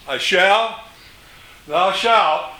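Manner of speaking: ordinary talk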